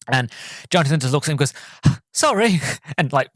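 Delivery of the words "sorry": high-pitched, mock-polite English sorry; very English apology. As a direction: apologise like a terribly English gent. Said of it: "very English".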